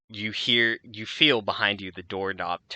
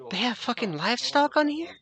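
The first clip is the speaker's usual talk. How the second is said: high, disbelieving guard; can't believe there's livestock; incredulous guard. Acted. Guard asking his partner, high and disbelieving.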